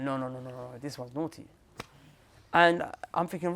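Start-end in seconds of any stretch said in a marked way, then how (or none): 0.0-1.5 a hint of a grumbling uncle; stern uncle. Uncle dismissing the boy, lower and softer. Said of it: "He's like".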